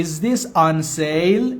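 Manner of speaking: slow, carefully spaced English; slow teaching demo. Shown as a demonstration. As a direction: spell it out slowly for learners. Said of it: "teacher models phrase for learners".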